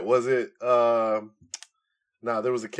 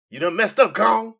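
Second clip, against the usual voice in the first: louder, higher trash-talk callout; wrestling promo; 10 semitones higher, 7 dB louder than usual. Pretend. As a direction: call him out like a heel. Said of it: "hit Steiner Math promo".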